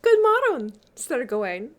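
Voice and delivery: high, loud, lilting greeting in Middle English. A narrator's reading. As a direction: swoop down from a high, bright opening.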